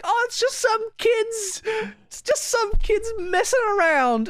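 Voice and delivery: high, slow, prissy imitation of the caller; mocking imitation. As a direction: mimic the lady's dismissive sigh in a silly high voice. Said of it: "and they're like".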